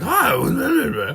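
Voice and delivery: high, loud squealing puppet dog. Retold or imitated. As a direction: yelp it out like a cartoon dog. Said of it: "just being like".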